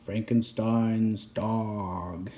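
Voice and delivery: slow, deep eerie title; spooky title voice. A narrator's reading. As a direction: drag it out ominously.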